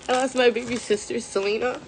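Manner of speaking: holding back tears as she says it; emotional. Real, not acted. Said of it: "I'm already getting emotional".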